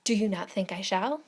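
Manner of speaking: 1 eager question. A narrator's reading. Miss Bates seeks assent.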